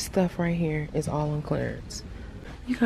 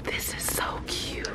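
whisper; 11 dB quieter than usual. Real, not acted. Whispering to camera in store.

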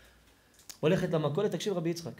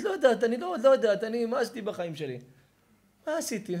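distraught mother; 7 semitones higher, 6 dB louder than usual. Acted. Acted mother laments her life.

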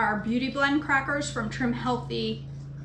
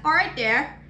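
put-on Canadian accent. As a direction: sing out "all right there" in a mock Down East accent. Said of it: "That was like a Canadian accent".

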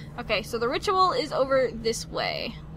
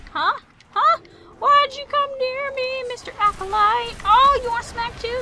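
mock scolding; 11 semitones higher, 4 dB louder than usual. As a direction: tease an enemy you're about to smack.

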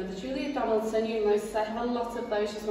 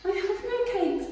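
much higher small rabbit voice; timid rabbit; 12 semitones higher, 4 dB louder than usual. Acted. Rabbit answering the rat, high and small. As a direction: squeak it out nervously. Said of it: "the rabbit replied".

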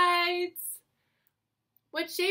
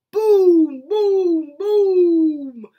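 very loud, held booms; big booming thunder imitation; 2 semitones higher, 12 dB louder, much slower than usual. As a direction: boom out like thunder, one clap per word. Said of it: "Mister Brown makes thunder".

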